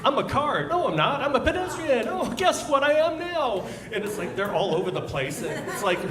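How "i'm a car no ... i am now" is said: high, whiny put-on cyclist voice; mock a smug cyclist. Comedian mocking cyclists who switch roles, high whiny voice.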